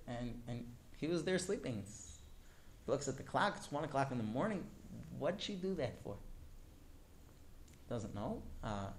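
usual speaking voice

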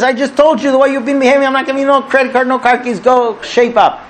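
high, rapid-fire rant from an exasperated dad; mock scolding. Dad turning down his kid's credit card request, scolding.

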